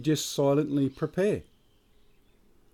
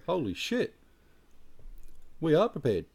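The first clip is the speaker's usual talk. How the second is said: stunned relief; 5 semitones higher than usual. Retold or imitated. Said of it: "look at you with blank faces and go".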